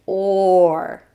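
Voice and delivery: a sustained "or" for others to join; drawn-out "or" sound. Shown as a demonstration. Teacher practises O R with learners, drawn-out "or". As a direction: stretch "or" long and steady. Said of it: "practice with me the sound".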